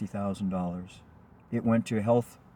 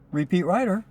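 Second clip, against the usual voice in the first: higher, louder, pleased recognition; excited inner thought; 8 semitones higher, 5 dB louder than usual. Retold or imitated. Driver thinking, a familiar passenger is calling again, excited. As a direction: think it with a jolt of glee.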